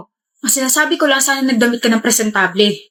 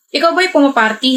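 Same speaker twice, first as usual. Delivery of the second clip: ordinary delivery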